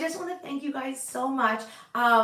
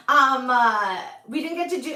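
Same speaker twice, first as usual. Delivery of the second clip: ordinary talk, nothing marked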